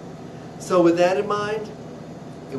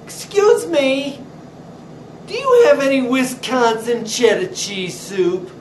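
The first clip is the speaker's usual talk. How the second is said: mimicked customer; 8 semitones higher, 5 dB louder than usual. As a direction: put on a prissy customer voice. Customer voiced in deli story.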